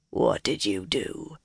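low, slow growl. A narrator's reading. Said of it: "he growled".

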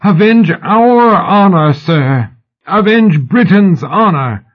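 high, drawn-out impassioned appeal; high-pitched theatrical demand; shrill shout. A narrator's reading. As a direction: cry out for honor across a theatre. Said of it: "Sybil shouted at him shrilly".

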